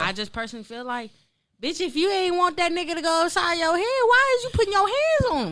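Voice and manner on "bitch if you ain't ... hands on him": high outraged question; indignant. Real, not acted. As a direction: let the outrage climb.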